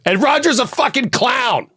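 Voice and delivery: high-pitched outburst; gleeful reveal. Acted. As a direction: burst out with an absurd reveal. GM springs the absurd reveal.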